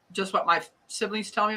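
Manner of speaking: ordinary delivery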